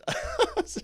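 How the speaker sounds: short high chuckle; amused burst of laughter; real laugh. Real, not acted. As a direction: giggle at your own attempt. Laughs at own joke.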